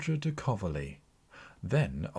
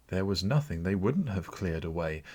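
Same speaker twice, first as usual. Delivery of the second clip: usual speaking voice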